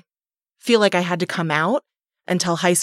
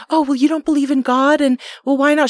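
high, sing-song mother voice; mock mom; 8 semitones higher than usual. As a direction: play the reasonable mom, high. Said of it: "her reaction wasn't even like".

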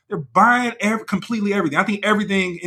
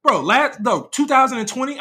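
imitating Trump; 6 semitones higher than usual. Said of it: "I'm sure Trump is like".

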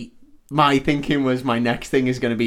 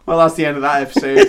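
laughing; 4 semitones higher, 6 dB louder, faster than usual. Real, not acted. Laughing sign-off.

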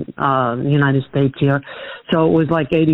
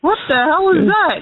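incredulous; 11 semitones higher than usual. Real, not acted. Caller scoffing at fried chicken at the coast, incredulous. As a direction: stretch it out in disbelief.

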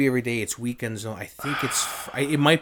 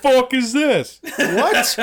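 high, loud, slow laughing outburst; laughing disbelief; 14 semitones higher, 8 dB louder, much slower than usual. Real, not acted. Mock shock at tiny menu.

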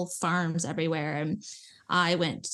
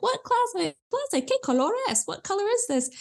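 put-on much higher, puzzled kid voice asking; confused student; 12 semitones higher than usual. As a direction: play the lost kid squinting at the colour. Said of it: "And he's like".

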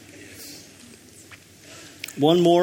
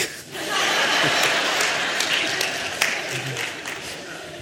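soft airy laugh; 3 semitones lower, 5 dB quieter than usual. Real, not acted. Laughs after dog joke.

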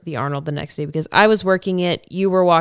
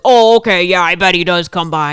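mock Dave; 8 dB louder than usual. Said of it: "Dave's like".

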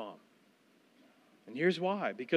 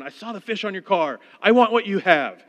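mock eager convert; 3 semitones higher, 7 dB louder than usual.

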